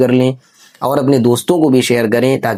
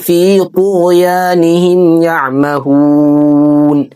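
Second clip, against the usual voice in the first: slow melodic tajweed chant; measured Quranic recitation; 5 semitones higher, much slower than usual. Shown as a demonstration. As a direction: recite each phrase slowly and melodically. Quran recitation between explanations.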